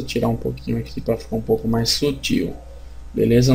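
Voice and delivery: ordinary talk, nothing marked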